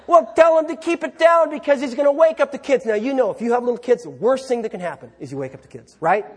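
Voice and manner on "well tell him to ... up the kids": higher, louder scolding; irritated wife. Acted. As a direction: snap it out like an annoyed wife. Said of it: "Your wife says".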